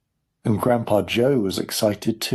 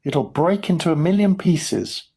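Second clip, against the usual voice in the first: higher, fearful protest; alarmed shout; 7 semitones higher than usual. A narrator's reading. Grandpa Joe fearing broken glass, alarmed shout. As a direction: warn with pitched-up alarm. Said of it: "shouted Grandpa Joe".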